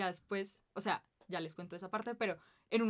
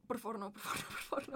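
mock panic plea; 2 semitones higher, 5 dB quieter than usual.

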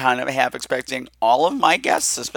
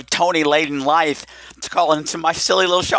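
normal talking voice

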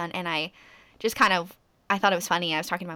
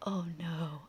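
mock whispered dismay; 3 semitones lower, 13 dB quieter than usual.

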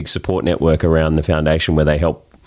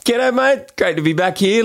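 excited greeting; 12 semitones higher than usual. Real, not acted. Cheery greeting on arrival.

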